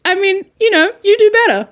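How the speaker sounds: sarcastic. Pretend. Mocking the critic back.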